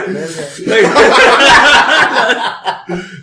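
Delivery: loud, falling belly laugh; long high laugh. Real, not acted. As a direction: laugh hard and let it trail down. Long laugh after the story.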